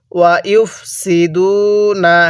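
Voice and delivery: slow drawn-out tajweed recitation; Quranic recitation. Shown as a demonstration. Teacher leading students to repeat, slow recitation. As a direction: stretch each syllable for the class to repeat. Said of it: "আমার সাথে পড়ুন".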